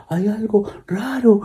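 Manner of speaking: high, slow frightened whisper; scared whisper. Acted. Girls whispering to dad in fear. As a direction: breathe it out in fear.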